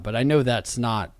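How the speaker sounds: normal talking voice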